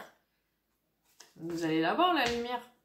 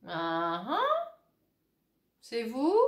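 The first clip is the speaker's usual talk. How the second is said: ordinary talk, nothing marked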